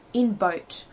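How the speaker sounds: ordinary delivery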